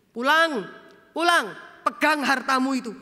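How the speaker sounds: stern voice of God. Acted. God voiced sending people home.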